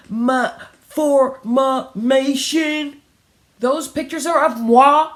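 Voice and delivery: a little girl acting grand; haughty child. A narrator's reading. Child mispronouncing with airs.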